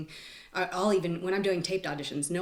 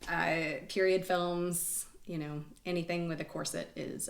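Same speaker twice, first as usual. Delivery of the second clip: normal talking voice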